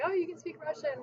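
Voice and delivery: high, bright-eyed impressed exclaim; lightly voiced impressed listener. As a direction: sound pleasantly amazed. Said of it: "people are more, like, impressed".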